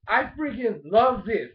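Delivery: usual speaking voice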